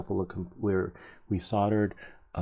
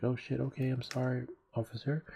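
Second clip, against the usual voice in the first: higher-pitched, placating retold apology; mock meek apology; 6 semitones higher, 3 dB quieter than usual. Cyclist apologises to angry officer, mock meek. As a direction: act out backing down to a cop.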